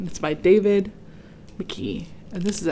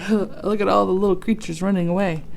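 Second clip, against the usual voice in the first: ordinary delivery, nothing marked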